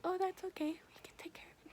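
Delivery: whispered, sing-song imitation of the nurses; mock soothing whisper. Mocking the nurses to her viewers, in a soft whisper. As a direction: hush it like a patronizing nurse. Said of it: "They're like".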